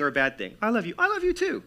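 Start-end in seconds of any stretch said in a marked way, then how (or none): 0.6-0.9 high-pitched mock exchange of love; quick sweet reply, pitched way up; loving exchange. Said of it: "That's a good thing". 1.0-1.6 very high, light sweet reply; affectionate echo, pitched way up; loving reply. Acted. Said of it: "That's a good thing".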